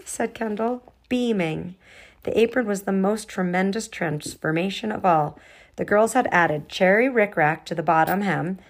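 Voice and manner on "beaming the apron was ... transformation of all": plain reading voice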